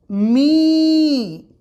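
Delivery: मी with a long drawn ī; long ī demo. Shown as a demonstration. Teacher showing students the long vowel in मी. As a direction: hold the ī in मी long. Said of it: "तो word बनेगा".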